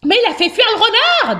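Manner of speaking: high-pitched little protest; protesting worm. A narrator's reading. Worm defending hamster to his father, high and insistent. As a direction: speak up in a high voice.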